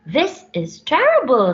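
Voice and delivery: loud, high exclamation; alarmed Bella. Acted. Bella panicking about the dragon. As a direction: cry it out in alarm. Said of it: "said Bella".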